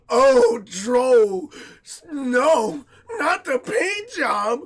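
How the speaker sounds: loud, high, drawn-out fake dismay; mock horror. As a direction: wail sarcastically as if it were a tragedy. Mocks fuss over scuffed paint.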